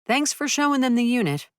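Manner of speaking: high, loud call down the walkway; calling after. A narrator's reading. Narrator calling thanks after the departing intern. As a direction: call after someone walking away. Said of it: "I called after Bolin".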